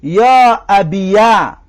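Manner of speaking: «یا ابیه» slow, with an open "ya" (fatha on the ya); fatha on ya demo. Shown as a demonstration. Teacher shows students the fatha form, slow and clear. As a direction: stretch «یا ابیه», leaning on "yaa".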